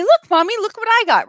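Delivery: put-on high, loud child showing off; excited little child. Child showing Mommy what she took, excited. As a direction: imitate a kid calling out to mommy.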